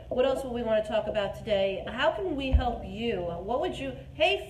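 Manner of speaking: ordinary delivery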